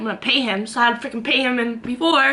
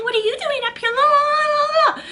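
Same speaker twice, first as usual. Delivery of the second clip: much higher, sing-song taunting voice; mocking street voice; 4 dB louder than usual. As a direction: mimic the street people in a high sing-song. Mocking street people accosting her.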